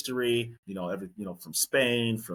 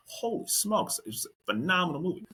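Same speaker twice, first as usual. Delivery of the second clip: retold amazement; 9 semitones higher than usual. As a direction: recall being blown away, pitch jumping up. Retelling his amazed first reaction.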